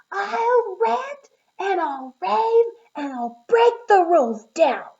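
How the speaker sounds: slow, loud, high angry scream; screamed tantrum. Acted. Schubert screaming his tantrum at the teacher, loud and slow. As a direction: scream it out like a furious little bug. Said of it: "He screamed".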